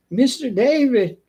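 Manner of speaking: high, slow Mister David dropping on David; imitating the old lady. Pest guy mimics the customer calling him, high and slow. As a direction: copy her singsong way of saying his name. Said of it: "That's the way she says it".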